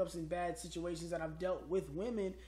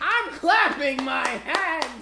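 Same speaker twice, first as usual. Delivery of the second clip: much louder, higher, slow; loud clapping joke; 9 semitones higher, 17 dB louder, much slower than usual. Pretend. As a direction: ham up the applause. Loud joking applause.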